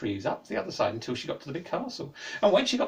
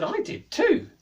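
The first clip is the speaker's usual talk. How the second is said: put-on excited girl; 6 dB louder, much slower than usual. Girl delighted he's back.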